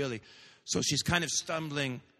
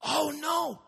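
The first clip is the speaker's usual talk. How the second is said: startled; 10 semitones higher than usual. Acted. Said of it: "she jumps and she says".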